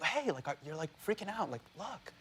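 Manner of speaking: hushed, gentle coaxing; soft soothing wife. Retold or imitated. Wife soothing him in balloon.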